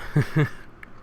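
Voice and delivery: brief breathy chuckle; quick laugh at one's own remark; short laugh. Real, not acted. Reader amused by a blunt comment, short laugh. Laughs after "fuck them.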